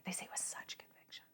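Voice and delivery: hushed, barely voiced whisper; whispered repeat. Real, not acted. Comic repeating the point to listeners, intense whisper. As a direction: drop to a whisper for emphasis.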